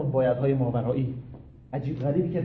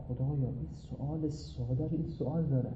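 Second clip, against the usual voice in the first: quiet aside to God; 3 semitones lower, 9 dB quieter than usual. Retold or imitated. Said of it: "گفتم".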